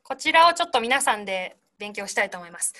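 ordinary delivery

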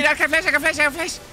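high, urgent hype call; breathless caster excitement; excited shout. Real, not acted. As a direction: yell it as the play unfolds. Hyped casting of a fight.

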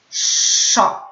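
"Szo" with a long hushing sz before the vowel; drawn-out SZ. Shown as a demonstration. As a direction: stretch the sz in "Szo", tongue high.

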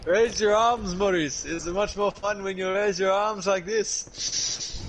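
high, loud cartoon party king; slow, high, exuberant character voice; King Julian. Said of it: "King Julian!".

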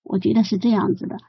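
ordinary talk

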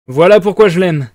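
loud, fervent outburst; exclaimed. A narrator's reading. Razumikin exclaims his fondness for Raskolnikov, loudly. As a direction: blurt it out with admiration. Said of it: "s'écria Razumikin".

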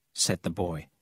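usual speaking voice

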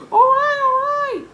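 very high, loud giving-in; exasperated George. Acted. George told to leave by his double, loudly exasperated. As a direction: cry it out, fed up.

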